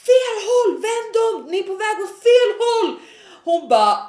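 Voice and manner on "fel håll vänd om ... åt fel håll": high, loud retold shout at passing police; acted high-pitched call-out; urgent shout. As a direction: re-enact yelling after someone going the wrong way.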